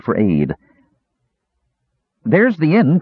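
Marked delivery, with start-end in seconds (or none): 2.2-3.0 high-pitched excited call; eager kender's cry. A narrator's reading. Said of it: "cried Earwig".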